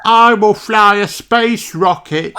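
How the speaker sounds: put-on high, loud child shout; little Danny Dog voice. Danny calling out his idea to teacher, loud and high. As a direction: blurt it out eagerly.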